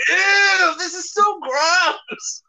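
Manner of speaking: high, loud, drawn-out mock disgust; grossed-out squeal. Friend acts out landing inside the other's head, squealing in disgust. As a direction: squeal it slowly, hamming up the revulsion.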